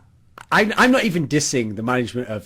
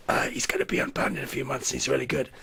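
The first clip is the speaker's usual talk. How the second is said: hushed reply; 7 dB quieter than usual. Acted. Teammate justifying the analyst's signing, hushed. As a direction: answer quietly under your breath.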